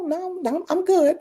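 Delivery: high-pitched light mock refusal; polite refusal. Brushing off advice in retold reply.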